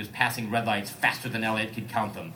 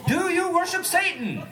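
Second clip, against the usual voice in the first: high, much higher hammed-up roar; roaring command; 3 dB louder than usual. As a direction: yell it at the driver in a high comic voice. [name] demanding of driver.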